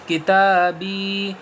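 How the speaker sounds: کتابی drawn out slowly, syllable by syllable; slow joined reading. Shown as a demonstration. Teacher blending letters into کتابی, slow and stretched. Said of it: "ایسے۔".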